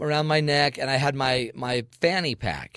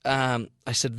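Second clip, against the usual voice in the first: normal talking voice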